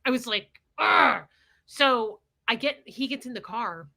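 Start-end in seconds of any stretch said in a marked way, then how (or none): 0.8-1.2 angry groan. Retold or imitated. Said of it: "I was so angry".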